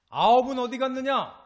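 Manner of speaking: high, booming challenge; loud demanding question. Acted. Jesus asks after the missing nine, loud, high demand. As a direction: demand an answer at full voice.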